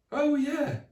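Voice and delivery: high sudden remembering; sudden realisation. Acted. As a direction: catch yourself remembering.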